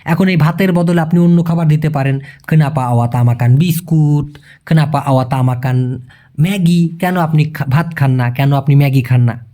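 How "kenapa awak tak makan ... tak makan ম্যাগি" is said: very slow, each word separate; Malay sentence said very slowly; slow Malay demo. Shown as a demonstration. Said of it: "teaching Malay sentence slowly".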